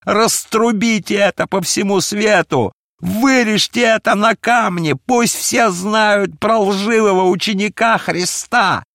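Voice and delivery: loud, very high raging shout; wild, anguished fury; furious. A narrator's reading. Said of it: "яростно продолжал".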